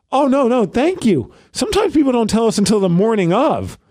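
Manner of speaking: reassuring customer-service lady. Retold or imitated. Retold mover's reassuring grateful reply.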